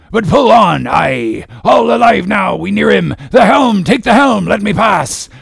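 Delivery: high, loud, frantic captain; shouted commands. A narrator's reading. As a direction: bellow urgent orders over the oars. Captain shouting orders mid-chase.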